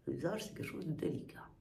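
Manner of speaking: soft whisper for delicate; hushed and gentle. Real, not acted. Gentle face massage advice.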